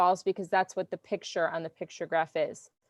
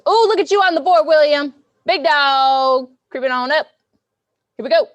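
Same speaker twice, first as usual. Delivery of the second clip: louder, higher game-show hype over the leaderboard; excited hype; 7 semitones higher, 11 dB louder than usual. Real, not acted. Teacher hyping a student on the leaderboard, excited and loud. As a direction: cheer the climber on like an announcer.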